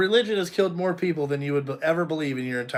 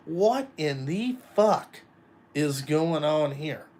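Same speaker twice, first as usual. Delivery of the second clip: slow, drawn-out disbelief; retold bewilderment; slower than usual. Groom asking his wife what is happening, retold bewilderment. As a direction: space out every word, incredulous.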